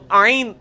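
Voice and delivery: "آئین" stressed from the throat; letter ain. Shown as a demonstration. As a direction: lean on "آئین". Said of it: "یہ لفظ کیا ہے؟".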